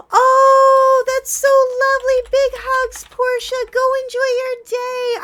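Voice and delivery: very high, loud, sing-out affection; gasp, then gush loudly at a dear friend; gushing delight. Real, not acted. Gushing farewell to a viewer.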